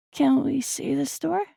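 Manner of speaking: put-on high, slow child's plea; a kid perking up with hope; eager little boy. A narrator's reading. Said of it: "his voice more awake now".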